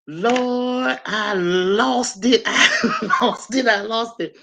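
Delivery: laughing helplessly through the words. Real, not acted. Telling friends about the mouse, breaking into laughter. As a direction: lose it laughing, slow and high.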